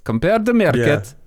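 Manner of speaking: "Compare the meerkat" in a mock Russian accent, market sounding like meerkat. Shown as a demonstration. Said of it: "You have a very good Russian accent".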